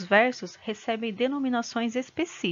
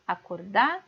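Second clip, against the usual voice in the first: "Acordara" split before the last syllable, DA stressed and rising; "Acordara" as acorDÁ, pause, ra. Shown as a demonstration. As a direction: lean on "da" in "Acordara", then drop "ra" separately. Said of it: "Qual que é a sílaba mais forte".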